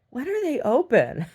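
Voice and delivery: high, loud mock question; woman customer. Woman asking about bakery hours, high and loud. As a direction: demand it in a piping put-on voice.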